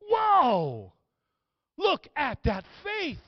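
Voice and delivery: loud, high, stretched-out whoa; astonished shout. Acted. Jesus seeing the friends' faith, astonished shout. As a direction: burst out in amazement, stretching the words.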